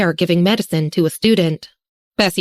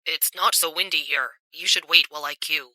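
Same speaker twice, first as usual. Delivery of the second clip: much softer, low whisper; 3 semitones lower, 9 dB quieter than usual. A narrator's reading. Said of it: "He lowered his head and whispered".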